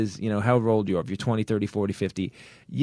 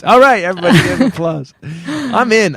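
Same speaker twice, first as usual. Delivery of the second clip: loud excited; 7 semitones higher, 8 dB louder than usual. Real, not acted.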